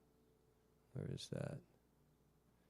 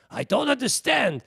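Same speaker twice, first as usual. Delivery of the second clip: light imitation of an old Yiddish accent, higher; 10 semitones higher, 3 dB louder than usual. Retelling the rabbi's complaint about the dirge, in a light Yiddish accent. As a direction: mimic a rabbi's broken Yiddish English, mildly. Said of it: "in his old Yiddish accent".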